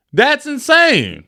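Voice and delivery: can't believe what he heard; incredulous. Real, not acted. Friend reacting to the one big bill, incredulous.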